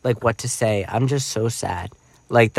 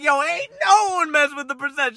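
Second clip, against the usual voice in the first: put-on hyped kid. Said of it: "And I'm like".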